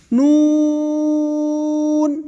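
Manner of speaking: نون with lips rounded, the u held long; slow round نون; rounded long noon. Shown as a demonstration. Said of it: "ہونٹ کو گول کر کے".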